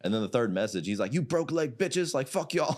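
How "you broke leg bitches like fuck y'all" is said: high mock-angry rant; imitated trash talk. Coach reads out opponent's angry message, high mock trash talk. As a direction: voice the furious message for laughs. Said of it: "just talking shit".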